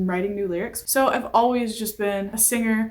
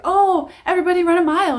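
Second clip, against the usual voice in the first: bossy gym teacher; 8 semitones higher, 6 dB louder, faster than usual. Retold or imitated. Gym teacher giving orders.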